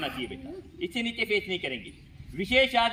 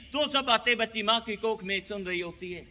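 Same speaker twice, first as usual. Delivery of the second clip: normal talking voice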